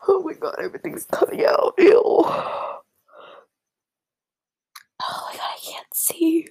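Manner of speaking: real tears, voice high and breaking; crying hard. Real, not acted. Vlogger overwhelmed by a friend's message, crying hard. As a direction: sob through the words, barely holding it. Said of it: "I'm crying so hard".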